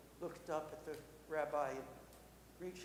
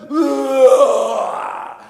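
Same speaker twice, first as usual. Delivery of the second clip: loud, drawn-out monster roar; full-volume werewolf bellow; 7 semitones higher, 25 dB louder than usual. Acted. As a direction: answer with a long beast roar. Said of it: "And the werewolf went".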